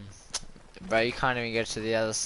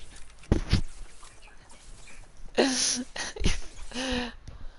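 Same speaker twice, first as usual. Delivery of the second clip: high breathless laugh; 12 semitones higher than usual. Real, not acted. Laughs after rage.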